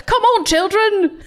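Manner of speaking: bad high-pitched mascot accent; slow, high attempt at a cartoon accent; hammed-up accent for a cereal mascot; Toucan Sam. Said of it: "I think it was more like".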